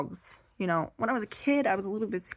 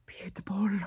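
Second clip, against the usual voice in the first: whisper; 4 dB quieter than usual. Retold or imitated. Whispering to pit bull.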